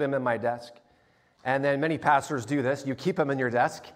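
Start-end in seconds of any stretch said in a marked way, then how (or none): none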